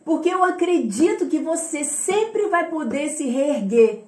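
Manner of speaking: ordinary talk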